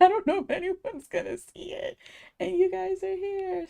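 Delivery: high, giddy happy rush; delighted laughter in the voice; excited. Real, not acted. Happy viewers showed up.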